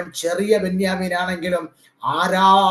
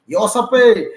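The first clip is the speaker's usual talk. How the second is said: calling out; 4 semitones higher, 8 dB louder, much slower than usual. Acted. Preacher calls out to Joseph.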